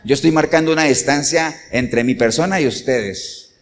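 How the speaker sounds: normal talking voice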